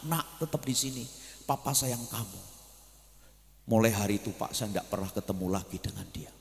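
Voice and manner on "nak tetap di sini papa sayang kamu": tender comfort. Retold or imitated. Comforting the orphan boy.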